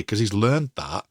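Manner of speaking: ordinary talk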